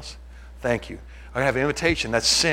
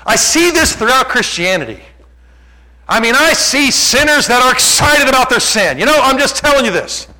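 preach at full force; 11 semitones higher, 8 dB louder than usual. Acted. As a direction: belt it out like a fired-up preacher.

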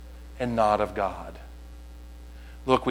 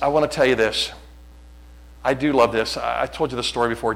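usual speaking voice

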